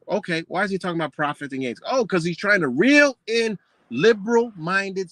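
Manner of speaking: ordinary talk, nothing marked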